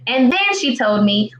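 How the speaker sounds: ordinary talk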